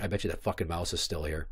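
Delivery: low hushed suspicion; under his breath, sure the mouse is lurking; muttered to himself. Retold or imitated. Alone at night in the kitchen, hushed suspicious mutter. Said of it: "I'm like".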